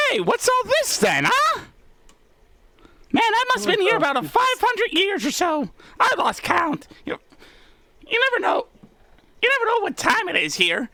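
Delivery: put-on high chatty old-timey cop voice; 1930s beat cop. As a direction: play a nosy beat cop, pitched high. GM voices Charlie greeting patrons.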